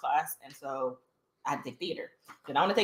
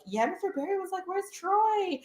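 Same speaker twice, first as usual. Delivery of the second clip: higher voice throughout; lightly voiced friend; friend quoting; 12 semitones higher, 3 dB quieter than usual. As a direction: retell what the teacher asked. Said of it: "my friend was just like".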